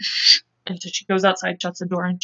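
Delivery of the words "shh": quick hushing "shh"; shush. Acted. Shanda hushing her friends at the door, shushing. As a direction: shush them mid-word.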